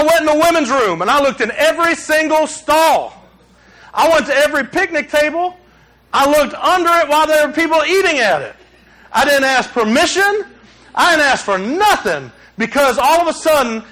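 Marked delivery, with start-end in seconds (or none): none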